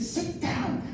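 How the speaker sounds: high, hushed urgent order; acted wife telling him off under her breath; hushed scolding. Wife to husband at a hockey game, hushed scolding.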